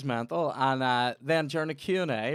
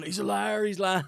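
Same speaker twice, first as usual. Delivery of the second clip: high mock panto heckle; pantomime crowd; 7 semitones higher, 4 dB louder than usual. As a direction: jeer like a pantomime audience. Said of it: "It was like pantomime".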